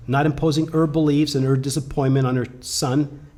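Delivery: usual speaking voice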